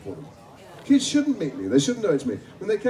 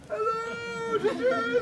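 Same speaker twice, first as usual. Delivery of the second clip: high distant call from another mountaintop; far-off shout; 5 dB quieter, slower than usual. Retold or imitated. Stranger shouting from the next mountaintop, high distant call. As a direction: hail him from across the valley, slow and high. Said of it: "from the neighbouring mountaintop I heard".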